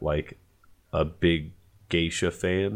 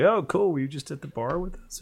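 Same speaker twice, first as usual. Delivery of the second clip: much higher bright joking question; mock cheerful; 11 semitones higher than usual. Rider joking with Uber driver, high mock-cheerful. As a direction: tease the driver playfully.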